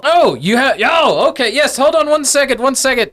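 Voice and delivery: high, loud, hurried stalling; frantic squeaky professor calling out; flustered gnome professor. Acted. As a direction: stall visitors in a panicky high voice. Gnome professor flustered behind the door.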